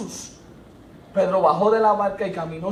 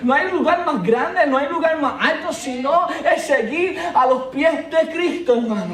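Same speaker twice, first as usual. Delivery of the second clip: higher, louder, building preacher call; rising preaching fervor; 8 semitones higher, 9 dB louder than usual. Acted. Pastor urging the congregation, building fervor. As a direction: lift the congregation with each ven.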